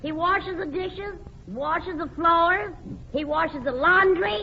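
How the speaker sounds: drawn-out slow list of chores. Acted. Wife telling the host about her friend's husband, slow list. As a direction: lay out each chore slowly for the punchline.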